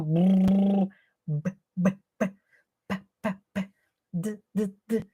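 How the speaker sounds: isolated consonant sounds, soft and exaggerated; articulation sounds. Shown as a demonstration. Teacher modelling consonant sounds for a child, soft and exaggerated. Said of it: "Usar a articulação".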